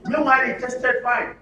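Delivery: ordinary delivery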